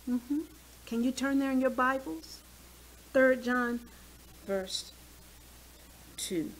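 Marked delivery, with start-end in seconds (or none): none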